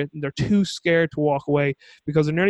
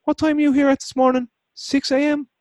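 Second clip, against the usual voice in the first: mock coworker; 11 semitones higher than usual. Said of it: "the game of one-upsmanship".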